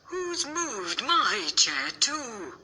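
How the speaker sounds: high, drawn-out mother bear voice. A narrator's reading. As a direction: soar up like a fussy mother bear. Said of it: "and said".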